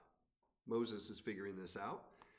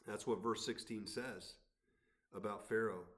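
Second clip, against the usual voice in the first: ordinary delivery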